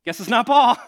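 loud, high punchline; comic realization. Acted. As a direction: exclaim it in amused disbelief. Punchline of mistaken identity story.

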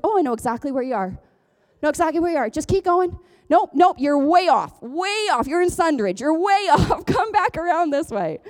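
high, insistent mock directions; sure-footed father. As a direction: play a dad confidently steering a lost caller. Acts father directing her by phone.

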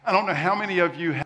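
quick rushed delivery; talking fast. Real, not acted. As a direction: hurry through to save time. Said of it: "so I'm gonna try to talk fast".

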